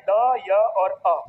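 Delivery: "द, य और अ" very slowly, each sound held separately; sounding out letters. Shown as a demonstration. Teacher splitting dya for students, "द, य और अ" slowly isolated. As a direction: stretch and separate "द, य और अ". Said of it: "कौन कौन से आते हैं".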